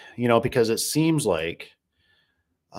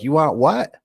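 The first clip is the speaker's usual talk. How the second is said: high, loud mock vendor asking in disbelief; baffled vendor; 6 semitones higher, 6 dB louder than usual. Vendor baffled by his order, mock incredulous. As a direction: play the confused vendor on the phone. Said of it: "they're like".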